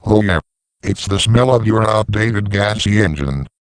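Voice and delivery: usual speaking voice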